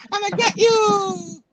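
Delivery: high witchy villain screech; Green Goblin voice. Retold or imitated. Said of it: "I sounded like a witch".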